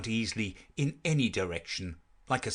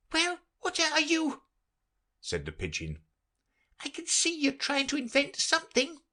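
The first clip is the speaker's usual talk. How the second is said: very high, fussy bird voice; fretful pigeon. Acted. The pigeon grumbling about serpents, very high fussy voice. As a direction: complain like a harried mother bird.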